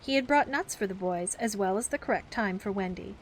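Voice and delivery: usual speaking voice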